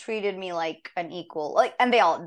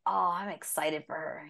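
hushed excitement; 7 dB quieter than usual. Retold or imitated. Actress thinking of her new game role, excited whisper. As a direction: whisper it like a secret thrill.

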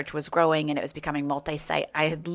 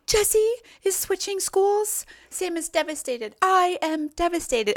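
put-on much higher, slow little-girl voice; imitating young daughter; 13 semitones higher than usual. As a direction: read the text as a sad kid. Voicing young daughter's text.

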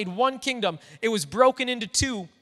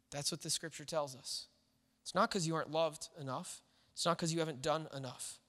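gentle reassurance; 5 semitones lower, 6 dB quieter than usual. Real, not acted. Pastor consoling grieving people who blame themselves, soft and tender. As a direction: ease them past their guilt, quietly.

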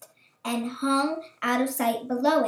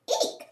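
very high squeak of alarm; mother bird squealing at the dangling chicks; startled eek; 4 dB quieter than usual. A narrator's reading. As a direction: shriek out a sudden eek. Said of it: "she cried".

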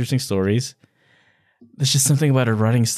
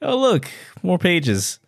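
mock weary reading; 6 semitones higher, much slower than usual. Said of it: "I'll be like".

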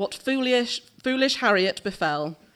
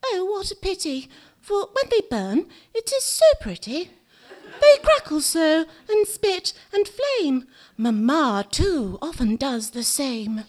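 Harriet's voice; 7 semitones higher than usual. A narrator's reading. Harriet admiring the burning matches, in a high childlike voice. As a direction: play a little girl charmed by the matches. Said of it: "But Harriet said".

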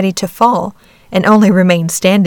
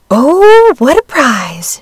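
delighted high cry, slowing down; excited exclaim; 6 semitones higher, 6 dB louder, much slower than usual. A narrator's reading. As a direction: gasp with joy at the find. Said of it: "she exclaimed".

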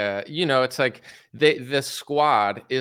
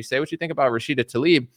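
normal talking voice

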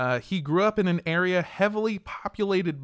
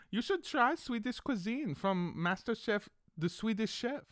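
fake Swedish accent; 6 semitones higher, 4 dB louder than usual. Fake Swede recommending the cuisine, mock Swedish accent. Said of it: "this is what we sound like in Sweden".

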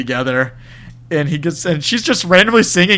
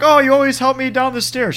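high, sweet neighbor voice; sweet old neighbor; 9 semitones higher than usual. Retold or imitated. Host voices the wheelchair neighbor sweetly. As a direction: coo it like a sugary neighbor. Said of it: "who's like".

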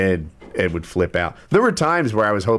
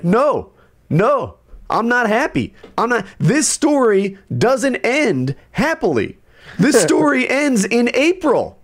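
retold rant; 13 semitones higher, slower than usual. Homeowner ranting at contractor about the delay, furious. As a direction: let the anger boil over at the contractor. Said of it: "at one point I was like".

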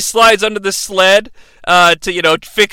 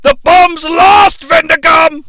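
hammed-up Lebowski fury; furious Uncle Claus; 8 semitones higher, 5 dB louder than usual. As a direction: yell at the elves in outrage. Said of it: "And he's like".

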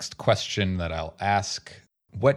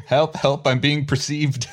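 higher-pitched mock cry for help; mock panic; 6 semitones higher, 4 dB louder than usual. Player jokes about being perceived, mock panic. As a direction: plead in playful alarm.

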